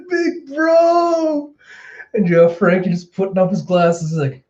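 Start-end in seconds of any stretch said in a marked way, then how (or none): 0.1-1.5 high, drawn-out wail of relief; overjoyed cry. Retold or imitated. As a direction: call out like a tearful fan. Nami cries out to Frankie.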